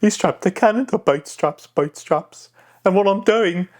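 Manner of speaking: high, squawky Cockney pirate; high pitch, pushed out through the front of the mouth; Ragetti. Shown as a demonstration. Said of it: "but it's a high pitch".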